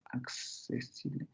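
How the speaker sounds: whispered accesible, unvoiced and slow. Shown as a demonstration.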